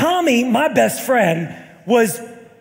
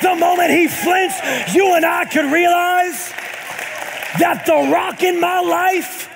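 preaching at full force; 5 semitones higher than usual. Acted. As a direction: build the sermon to a climax. Preaching climax over music.

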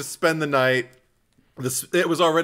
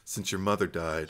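low, soft, hesitant delivery; Billy; 6 semitones lower, 8 dB quieter than usual. Retold or imitated. Boyfriend to Sidney about her mother, low and soft. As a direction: say it gingerly, voice dropped. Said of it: "when he's sitting there just kinda like".

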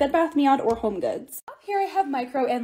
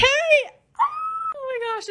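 excited squeal; 12 semitones higher, much slower than usual. Real, not acted. Sees finished shelf.

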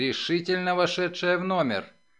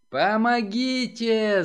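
raised, drawn-out cry for help; 4 semitones higher, slower than usual. A narrator's reading. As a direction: call out slowly, higher, pleading to be heard. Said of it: "закричала она во весь голос".

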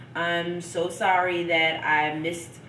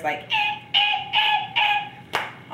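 pained yelps; slower than usual. Retold or imitated. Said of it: "somebody stabbed me".

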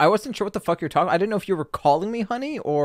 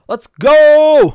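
loud high hype yell; hype shout; 11 semitones higher, 14 dB louder than usual. Real, not acted. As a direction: scream it with full hype. Hype at battle start.

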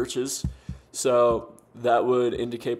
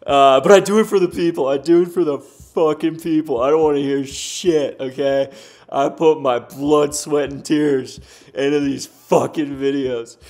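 loud, hammed-up devotion, slowing on blood, sweat and tears; mock heroic rant; 7 dB louder than usual. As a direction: play the martyr creator, over the top. Mock-heroic rant about his videos.

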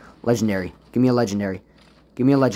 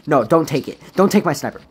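ordinary talk, nothing marked

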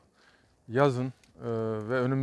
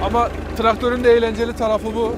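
raised voice; 6 semitones higher, 6 dB louder than usual. Real, not acted. Talking over a running tractor.

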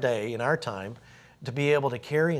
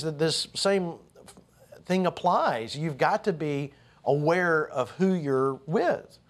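ordinary talk, nothing marked

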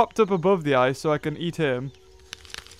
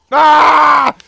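startled shout; 8 semitones higher, 9 dB louder than usual. Real, not acted. Startled in game.

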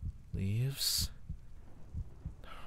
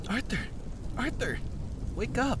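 higher, urgent cry to wake him; urgent call; 10 semitones higher than usual. A narrator's reading. Aldara shouts urgently to wake Arthur. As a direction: shake him awake with your voice.

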